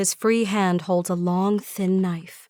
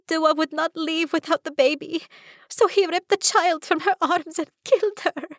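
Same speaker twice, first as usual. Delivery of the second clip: high, whimpering voice; voiced sister, much higher, tearful; 9 semitones higher than usual. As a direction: whimper it through grief. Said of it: "Estella whimpers".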